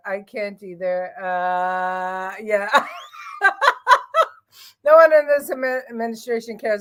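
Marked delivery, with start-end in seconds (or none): 2.7-4.3 high, loud shrieking laugh. Real, not acted. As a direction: burst into a shrill laugh. Big laugh.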